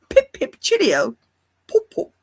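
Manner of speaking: high, punchy caricature of British English; mock British accent. [name] joking about going to England, mock British accent. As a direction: chirp it like a cartoon Brit. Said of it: "I can't go to England".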